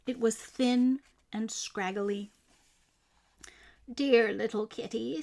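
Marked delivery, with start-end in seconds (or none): 3.9-5.2 put-on old woman tender. A narrator's reading. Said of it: "said the very old woman".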